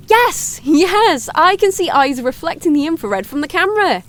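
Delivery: high, loud burst of delight; gasp, then exclaim high and loud; excited. Acted. Streamer spots eyes on the night camera, excited.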